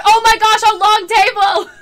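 high, loud shriek of joy; ecstatic shout. Real, not acted. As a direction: yell like a wish just came true. Thinks her prayers were answered.

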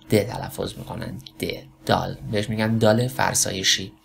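ordinary talk, nothing marked

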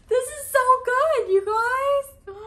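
high, loud, tearful squeal; teary squeal. Real, not acted. Vlogger finding the card and booklet, teary high squeal. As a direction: squeal it like happy tears are coming. Unboxing full set, overwhelmed.

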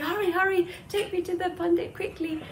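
high-pitched, acted-out urgency; urgent pleading. Worried milkmaid to the boatman who finally arrived, urgent. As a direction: voice a milkmaid hurrying someone along, pitched high.

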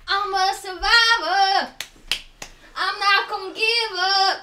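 slow, loud and determined; loud rallying cry. Real, not acted. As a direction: shout it like a battle cry. Hyping self through spicy pain.

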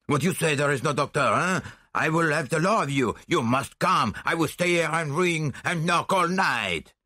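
put-on high, agitated caricature French accent; infuriated Frenchman. A narrator's reading. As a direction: rant like an outraged Frenchman. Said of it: "perfect caricature of an infuriated Frenchman".

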